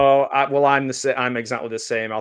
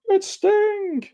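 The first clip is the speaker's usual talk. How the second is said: squealed. Acted. A fan spotting [name] on screen, squealing it in falsetto.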